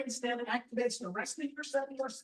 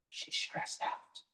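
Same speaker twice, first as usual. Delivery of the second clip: hushed aside; 10 dB quieter, much slower than usual. Pretend. Voicing what someone should have said.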